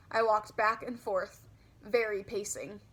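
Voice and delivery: ordinary delivery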